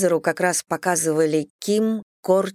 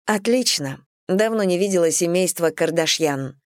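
ordinary delivery

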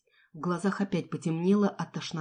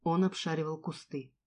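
ordinary delivery, nothing marked

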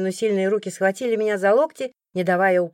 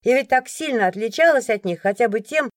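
ordinary delivery

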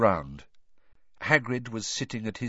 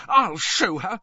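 shrill, loud vow; shaking with rage; 9 semitones higher, 5 dB louder than usual. A narrator's reading. Hermione fuming to her friends, shrill with rage. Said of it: "shaking with rage".

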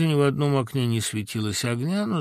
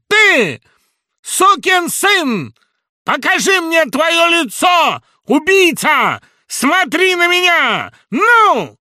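громкий высокий медленный крик; грозно, веско, с расстановкой; властный гнев; 11 dB louder, slower than usual. Acted. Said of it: "так веско и властно". (веско и властно кричит)

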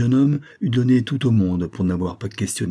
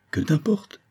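a sharp, higher snap back; brusque retort; 9 semitones higher than usual. Acted. Victor snapping curtly at his friend Cardasol. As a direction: snap at him curtly, pitched up. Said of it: "fit-il brusquement".

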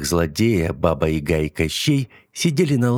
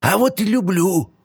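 very high, voice cracking squeal; shrill squeal; 13 semitones higher, 3 dB louder than usual. Acted. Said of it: "Голос Яги сорвался на ультразвук".

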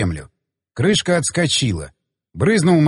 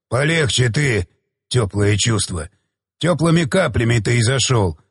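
lowered, unhurried rebuke; scornful, restrained low growl; low warning; 5 semitones lower than usual. A narrator's reading. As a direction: warn him off, pitched down. Father warns the daughter's suitor.

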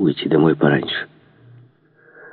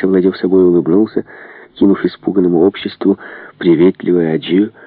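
usual speaking voice

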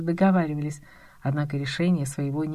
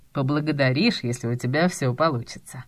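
lightly voiced boss, pitched lower; noncommittal boss; 4 semitones lower than usual. A narrator's reading. Boss deflects his employee's thanks, mildly noncommittal. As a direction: answer evasively, low and cool. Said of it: "ответил шеф обтекаемо".

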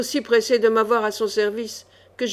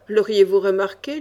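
plain reading voice

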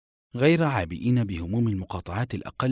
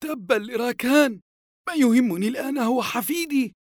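very high, loud angry outburst; acted exasperated fury; angry grandfather; 5 dB louder than usual. A narrator's reading. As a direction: curse and cut in, voice pitched way up. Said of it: "قاطعها الجد غاضبا".

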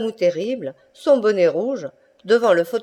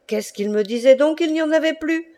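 high, startled outburst of disbelief; leaping back in shock, crying out; 8 semitones higher than usual. Acted. Tarascon man jumping back at the lion, startled cry. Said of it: "s'écria le Tarasconnais".

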